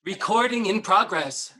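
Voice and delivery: high, slow, metallic robot announcement; booming robot voice. Pretend. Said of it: "That's my, that's my robot voice".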